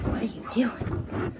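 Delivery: whispered dog voice, slow; slow breathy whisper of a confused dog; dog's voice. Retold or imitated. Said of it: "Dog's always like".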